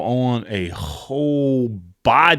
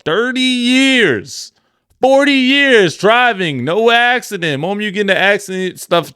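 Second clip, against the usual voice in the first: exasperated; 7 semitones higher than usual. Real, not acted. Friend ranting about insurance rates, exasperated and high. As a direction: stretch it out in disbelief.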